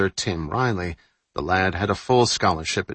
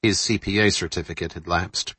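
ordinary delivery, nothing marked

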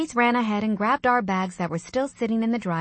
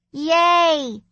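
put-on high, loud child's cheer; a kid's happy yay; 8 semitones higher, 6 dB louder than usual. A narrator's reading. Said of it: "Oscar cheered".

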